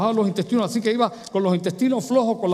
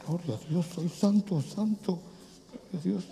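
murmured praise; 9 dB quieter than usual. Retold or imitated. Imitating worship murmurs.

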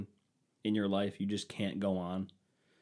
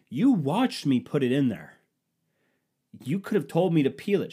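louder, slightly higher retold protest; arguing back; 6 semitones higher, 6 dB louder than usual. Husband blaming wife over the banana, loud retold anger. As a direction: push back hard at her. Retold argument, his own line.

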